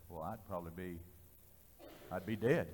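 low, soft, halting reply; acted-out sobered confession; subdued admission. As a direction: answer quietly with head hung. Man's head went down, sobered answer.

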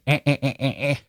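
staccato "eh eh eh" gun burst; mock tommy gun rattle. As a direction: rattle off a playful machine-gun noise. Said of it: "make a Tommy gun sound".

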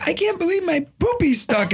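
high imitated child voice; little son. Son standing up to tell dad, high kid voice. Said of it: "he's like".

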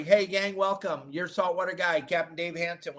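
ordinary talk, nothing marked

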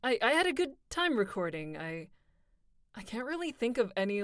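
normal talking voice

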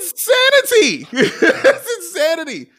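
high incredulous exclaim with a laugh; amazed exclaim. Real, not acted. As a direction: blurt it out in disbelief. Amazed at the opponent's shooting.

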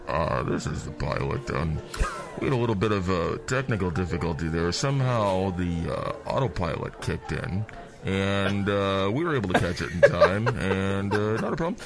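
calmly downplay the disaster like a pilot; announcing to the cabin, smooth pilot voice; airline pilot voice. Acted. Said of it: "your pilot voice comes on".

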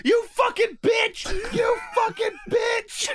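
high, slow screeching yell; mock crowd scream. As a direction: shriek it slowly like a crazed fan in the crowd. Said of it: "someone going".

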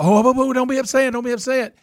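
high, sing-song mock comfort; baby him in a high fake-soothing voice; mock coddling. Mocking the audience coddling a crying singer, high mock coo. Mocks everyone's coddling reaction.

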